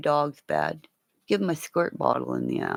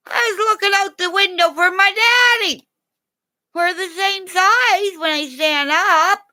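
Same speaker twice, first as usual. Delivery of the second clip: voiced dog, high; 14 semitones higher, 5 dB louder than usual. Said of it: "He's at the end of the bed going".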